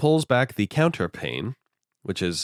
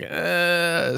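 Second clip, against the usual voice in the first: drawn-out grumbling noise, pitched up; 8 semitones higher than usual. Retold or imitated. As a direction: grumble reluctantly without real words. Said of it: "sort of grumbling".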